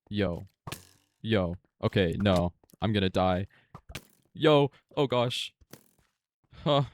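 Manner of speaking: loud, frantic yelps of alarm; panicked yelling. Real, not acted. Gamer yelling at a sudden attacker, loud panic. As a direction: shout in real panic as the mob attacks.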